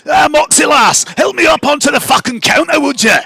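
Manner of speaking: high, loud, sweary little mushroom; high-pitched demanding yell; Fergus. Acted. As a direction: shout up for help in a shrunken character voice. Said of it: "you hear this voice that says".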